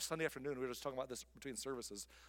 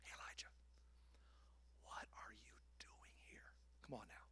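soft, much quieter whisper; tender hushed God voice; gentle whisper; 5 semitones lower, 18 dB quieter than usual. Acted. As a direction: bring the tone way down. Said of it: "He's bringing the tone down".

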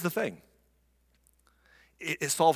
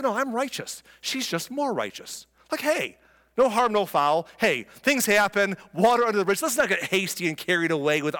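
smug backpedaling; 5 semitones higher than usual. Retold or imitated. Judah backpedaling self-righteously.

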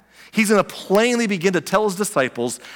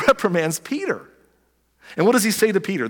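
ordinary talk, nothing marked

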